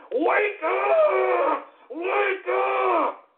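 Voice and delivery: an unhinged radio host yelling wake up; furious shout. Acted. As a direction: scream it at the whole country. Ranting radio host yelling at listeners.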